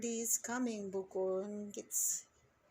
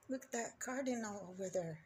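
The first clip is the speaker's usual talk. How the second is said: ordinary delivery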